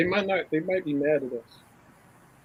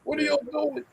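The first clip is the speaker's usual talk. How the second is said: Mike impression; 12 semitones higher than usual. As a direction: push the pitch up on the repeat. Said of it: "Mike will be like".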